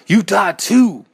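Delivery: high, loud vengeful howl. A narrator's reading. Narrator stabbing the second eye, howling. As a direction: howl it as you attack. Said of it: "I howled at it".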